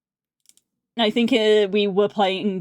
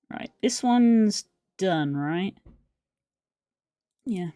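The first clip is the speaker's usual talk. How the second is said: normal talking voice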